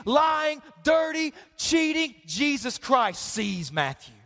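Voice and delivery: normal talking voice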